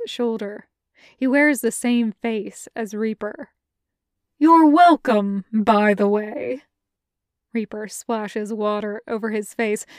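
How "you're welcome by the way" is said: slow, loud and pleased with himself; smug teasing. Acted. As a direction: rub it in, drawn out.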